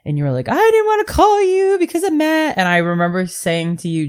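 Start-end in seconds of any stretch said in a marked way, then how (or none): 0.5-2.6 high, small voice; mimicking a tearful friend. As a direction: quote her in a much higher voice. Quoting friend's tearful reply.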